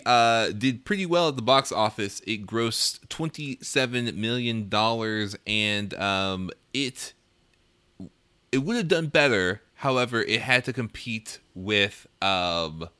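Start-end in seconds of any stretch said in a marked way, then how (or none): none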